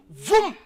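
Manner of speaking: loud high engine vroom; vroom car noise. Acted. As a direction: imitate a car zooming off.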